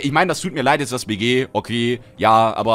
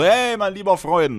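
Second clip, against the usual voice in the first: higher, slow and hearty mein lieber Freund; friendly greeting; 8 semitones higher, 3 dB louder, slower than usual. Retold or imitated. Greeting a good friend, hearty and friendly. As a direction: greet a buddy warmly. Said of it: "gibst dann auch".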